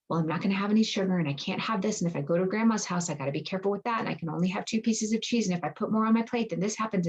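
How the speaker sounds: rushed, fretful listing of rules; anxious rushing. Shown as a demonstration. Coach voicing an anxious member's holiday plan, rushed and fretful. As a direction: rattle it off like a worried planner. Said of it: "You can hear the difference in the nervous system".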